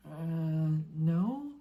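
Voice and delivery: unsure small answer; Harry admits he knows nothing, hesitant; boy's voice. Acted.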